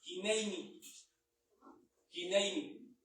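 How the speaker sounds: "Hineini" said hi-NEI-ni, falling at the end; "Hineini" with a clear "ei" glide in the middle; Hebrew pronunciation. Shown as a demonstration. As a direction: pronounce "Hineini" carefully as a Hebrew word. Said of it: "se pronuncia".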